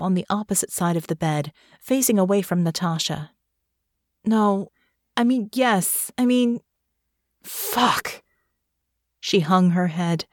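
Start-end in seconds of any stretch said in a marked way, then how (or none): 4.2-8.2 higher, halting self-correction; flustered frustration. A narrator's reading. Russo facing away from Natasha, flustered frustration.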